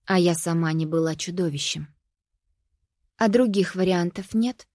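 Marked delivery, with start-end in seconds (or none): none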